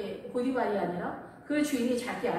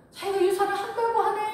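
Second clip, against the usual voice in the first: mocking mother-in-law; 10 semitones higher, 7 dB louder than usual. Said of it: "이렇게 얘기를 하는 거야".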